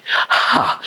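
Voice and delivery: ha pushed out from the lungs; one strong breath on ha; breathy h demo. Shown as a demonstration. Teacher counting in the class for the h. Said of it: "One, two, three".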